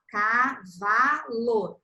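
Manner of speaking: cavalo stretched slowly syllable by syllable, ca-va-lo; slow syllable-split word. Shown as a demonstration. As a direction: say "Cavalo" slowly in three beats. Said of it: "a criança vai te falar".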